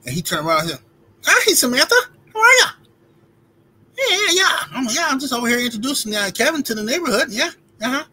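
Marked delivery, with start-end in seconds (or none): 1.2-8.0 much higher, louder nerdy squeak for the lady; Steve Urkel voice. Retold or imitated. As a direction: switch to a high, eager, nerdy voice. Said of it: "drop his voice down to Steve Urkel level".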